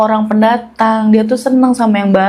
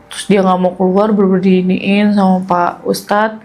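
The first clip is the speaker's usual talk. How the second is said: normal talking voice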